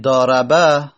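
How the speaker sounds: ضاربه said "daaraba", ending on open a; past-tense vowels. Shown as a demonstration. Teacher giving the full past form, final a sounded. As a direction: sound every a in ضاربه, the last one too. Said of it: "مادی هست".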